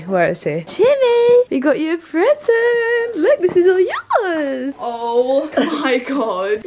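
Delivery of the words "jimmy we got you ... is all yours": high, drawn-out singsong call; excited pet talk. Real, not acted. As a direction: coo loudly and excitedly to the pet. Calling pet to a present.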